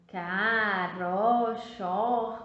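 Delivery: "Caroxó" sounded out very slowly; scrambled word read slowly. Shown as a demonstration. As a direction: read the jumbled "Caroxó" syllable by syllable. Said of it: "tá bem estranha".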